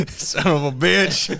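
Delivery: laughing curse. Real, not acted. Laughing after mock yelling.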